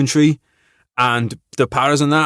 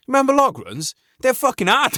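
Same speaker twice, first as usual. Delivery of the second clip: higher, animated and eager; light up at the memory; excited recall; 12 semitones higher than usual. Real, not acted. Excited memory of army runs.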